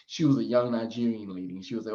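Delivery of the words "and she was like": normal talking voice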